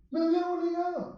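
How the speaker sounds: high-pitched imitation of a whine; mock reproachful wail. Preacher mimicking sister's complaint to mother, mock wail. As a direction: draw it out in put-on complaint.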